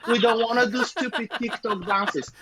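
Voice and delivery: high, mocking whine of moaning bands; mocking whiny voice.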